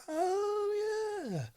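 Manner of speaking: high surprised "oh yeah"; sudden realisation. Retold or imitated. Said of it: "I'm like".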